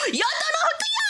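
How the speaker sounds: high, delighted squeal of a name; excited high greeting. Real, not acted.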